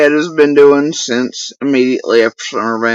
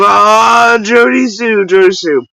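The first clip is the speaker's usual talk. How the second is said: higher, louder mock exclamation; imitating his outburst; 7 semitones higher, 5 dB louder, slower than usual. Imitating the man who booted him off the panel, mock outburst. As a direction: draw out a mocking "ah" and rise into it. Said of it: "And he goes".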